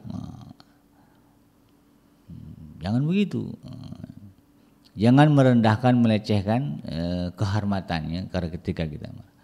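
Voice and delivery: normal talking voice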